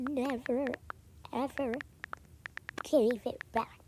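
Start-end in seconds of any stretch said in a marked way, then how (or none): none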